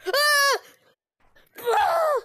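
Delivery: high loud scream as you fall. Real, not acted. Player falling off, screaming in panic. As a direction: shriek in sudden panic.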